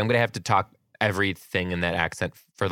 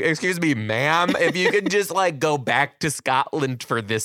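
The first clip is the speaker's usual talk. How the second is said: high, raised-voice exasperated appeal; incredulous, pointed request pitched up high; mock polite correction; 8 semitones higher, 3 dB louder, slower than usual. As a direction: call her out with mock courtesy.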